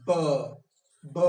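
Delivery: "b" said twice with lips pressed then released; repeated b sound. Shown as a demonstration. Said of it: "press the lips tightly".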